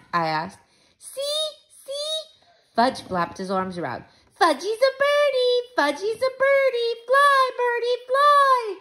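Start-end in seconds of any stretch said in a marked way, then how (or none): 1.0-2.2 put-on high-pitched toddler voice; Fudge showing off. A narrator's reading. Little brother demanding to be watched, high and childish. 4.4-8.8 put-on high, loud toddler chant; Fudge as birdie. A narrator's reading. Little brother atop the jungle gym, loud and squealing.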